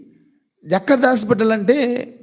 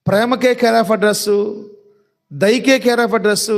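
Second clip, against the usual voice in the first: slow emphatic; 2 semitones higher, much slower than usual. Real, not acted. Preacher stresses God's address.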